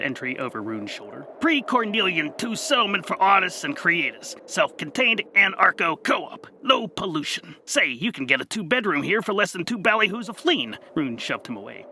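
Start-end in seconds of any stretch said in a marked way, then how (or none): 1.4-10.8 higher, louder, brisk sales pitch; eager reading Gax. Acted. The Gax reads an entry over Rune's shoulder, eager and high. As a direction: read it like an eager brochure.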